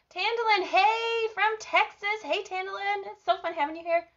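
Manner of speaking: high-pitched, gushing hello; excited greeting. Real, not acted. Host greets viewer from Texas, excited. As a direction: welcome them in a lifted singsong.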